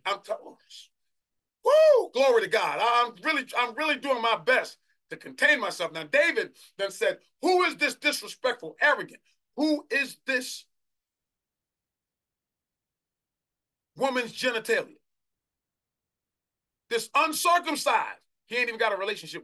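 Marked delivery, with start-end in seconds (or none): 1.6-2.1 very loud, high "woo"; sudden piercing whoop of praise; ecstatic whoop. Real, not acted. Preacher overcome with fervour. 2.1-19.4 loud, high pulpit outburst; raised, forceful challenge to the enemy; fervent preaching. Real, not acted.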